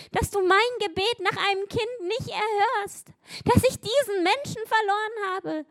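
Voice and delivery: desperate believers shouting why at God; despairing outcry. Acted. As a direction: wail the why at heaven. Despairing people crying out.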